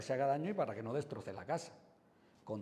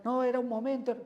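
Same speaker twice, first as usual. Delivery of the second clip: much higher and louder, protesting in a fluster; flustered driver; 12 semitones higher, 9 dB louder than usual. Retold or imitated. An upset driver begging the policeman, loud and high. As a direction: plead in a rush like a caught driver. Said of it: "tú te puedes alterar".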